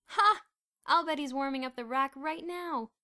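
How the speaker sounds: scoffing. A narrator's reading. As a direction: scoff at the idea. Bruno scoffing darkly.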